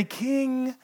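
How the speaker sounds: quiet, slow, sly evil counsellors; a hint of hissing villains, soft and slow; evil schemers' voice. Governors pressing the king, lightly voiced as sly villains. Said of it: "why evil people always talk that way".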